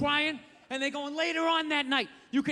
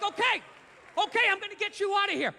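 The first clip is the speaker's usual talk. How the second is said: high-pitched, eager promise; urgent reassurance; 7 semitones higher than usual. Acted. Rescuer promising the gorilla an escape, high and urgent.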